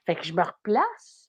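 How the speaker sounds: ordinary delivery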